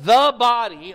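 THE loud and stretched before body; weighty, separated THE, then body; stress on THE. Real, not acted. Teacher marking the key phrase to underline, stress on THE. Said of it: "underline the body".

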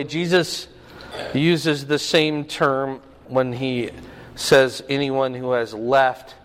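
normal talking voice

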